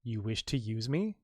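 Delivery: quiet question. Retold or imitated. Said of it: "asks the question".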